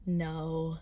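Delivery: low, soft imitated sleep-talk; eerie sleepy reply. Storyteller as sleep-talking friend, low eerie answer. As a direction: answer low and softly, as if asleep.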